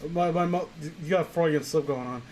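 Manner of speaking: usual speaking voice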